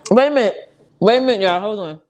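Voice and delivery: chewing while talking. Real, not acted. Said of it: "I'm eating in y'all face".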